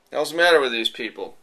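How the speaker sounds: ordinary talk, nothing marked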